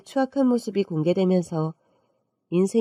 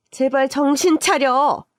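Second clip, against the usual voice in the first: slow, higher-pitched, emphatic rebuke; exasperated scolding; 5 semitones higher, 3 dB louder, slower than usual. Acted. Telling the lover to come to their senses, exasperated. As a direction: drag it out in exasperation.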